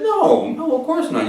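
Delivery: high-pitched, reassuring denial; retold soothing protest, pitched well up; quick reassurance. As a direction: reassure someone in a lifted voice. Retold reassurance to upset man.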